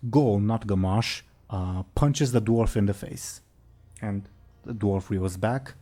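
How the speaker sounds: ordinary delivery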